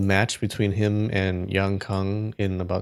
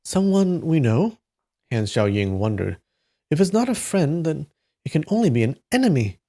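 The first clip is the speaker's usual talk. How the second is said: animated dialogue, higher on the quotes; story narration; 5 semitones higher, 4 dB louder than usual. A narrator's reading. Reader voicing masters debating friend or enemy, animated narration. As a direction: play the questions up, drop for the attributions. Reads the novel aloud.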